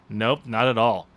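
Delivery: ordinary talk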